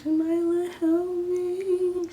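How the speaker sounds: high, loud wailing call for help; mock crying plea. Retelling her high crying plea to her friend, loud and wailing. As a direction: cry out to Jamila, panicked.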